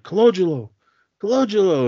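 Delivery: high, drawn-up mock call; retold yell. Lorenzo calling to his son, high mock yell. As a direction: imitate calling out a name, pitch well up. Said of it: "he's yelling at him".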